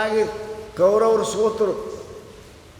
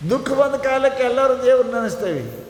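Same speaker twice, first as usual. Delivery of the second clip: forceful preaching; 9 semitones higher, 3 dB louder than usual. Acted.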